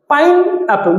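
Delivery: "Pineapple" in two separate beats, pine and apple; syllable split. Shown as a demonstration. Teacher counting two parts for students, "pineapple" divided in two. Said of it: "দুটো syllable".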